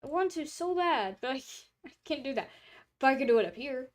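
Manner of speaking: ordinary talk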